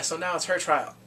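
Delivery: normal talking voice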